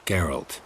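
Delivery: Geralt with a hard final t, not Gerald with a d; correct name. Shown as a demonstration. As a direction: end Geralt crisply on a t. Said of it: "Not Gerald".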